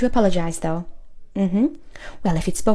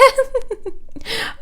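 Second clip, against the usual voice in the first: cackling laugh; 13 semitones higher than usual. Acted. Laughs after telling off Keith.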